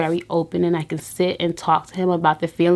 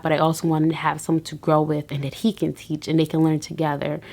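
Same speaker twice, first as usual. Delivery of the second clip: usual speaking voice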